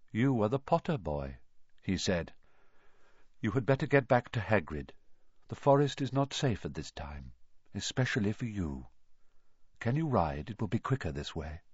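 grave and kind, keeps it low; calm centaur. A narrator's reading. Firenze warns Harry about the forest, calm low voice.

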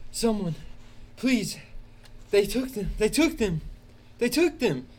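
high, loud, tearful begging; crying plea. Acted. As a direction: beg for help through sobs. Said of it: "panting and crying".

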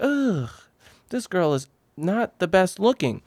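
high mocking groan of distaste; disgusted ugh. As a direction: sneer it, turning up the nose. Retold first reaction.

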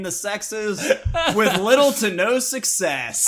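epic narrator voiceover; movie trailer voiceover. Acted. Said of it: "That sounded like a voiceover for".

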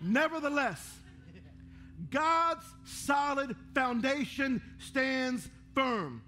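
each word of the verse drawn out slowly; slow solemn reading. Shown as a demonstration. Reading the verse on the screen to the church, slow and solemn. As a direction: lay the verse down like stones, very slow. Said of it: "Isn't that ironic?".

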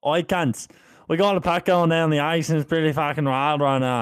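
put-on rowdy Australian commentator, Australian accent. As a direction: shout it like a wild Aussie caller. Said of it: "Australian golf matches".

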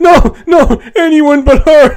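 high loud desperate plea, slowed; beg, voice cracking up high; Homer pleading. Retold or imitated. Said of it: "He's like".

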